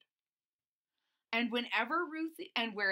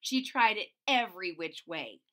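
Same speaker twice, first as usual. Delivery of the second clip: usual speaking voice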